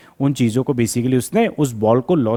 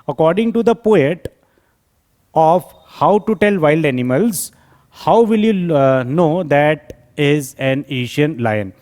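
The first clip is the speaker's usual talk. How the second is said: measured teacher's dictation with pauses; slow dictation; much slower than usual. Acted. Teacher reading an exam question to students, slow dictation. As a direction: read out slowly, phrase by phrase. Reads question aloud slowly.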